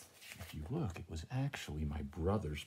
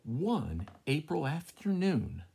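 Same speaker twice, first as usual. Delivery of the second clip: storybook opening; 7 semitones higher, 5 dB louder, slower than usual. Acted. Reading story aloud.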